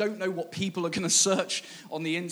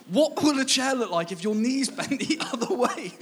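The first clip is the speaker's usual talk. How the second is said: higher, breaking into a laugh mid-line; laughing reread; 6 semitones higher than usual. Real, not acted. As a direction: reread it, cracking up halfway. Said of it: "Just soak up that mental image".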